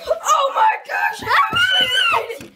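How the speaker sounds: very high, slow stretched shout; excited shouting. Real, not acted. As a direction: scream with delight. Flip lands, excited.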